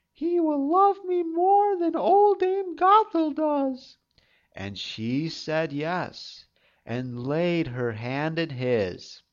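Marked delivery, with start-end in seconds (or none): 0.1-4.0 put-on high girlish storybook voice; high young maiden voice; Rapunzel's voice. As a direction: think it as a hopeful young girl.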